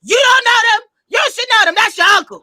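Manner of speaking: loud, high scolding grandma impression; strong grandmother. Granddaughter mimicking her grandmother's reaction to not knowing relatives, forceful and loud. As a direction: imitate a forceful grandma setting you straight. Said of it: "who is the strong one".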